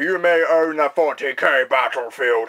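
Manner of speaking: loud gloating villain taunt; mad scientist. Acted. Villain taunt after sign-off.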